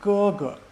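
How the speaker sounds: "ge, ge" as high level syllable, then short neutral one; high steady "ge", brief unstressed "ge"; first then fifth tone. Shown as a demonstration. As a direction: hold the first "ge" high, drop off on the second. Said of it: "that's first tone".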